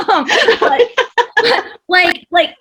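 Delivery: laughing through the words. Real, not acted. Host laughing at her own cheesy line. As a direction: giggle as you restart the thought.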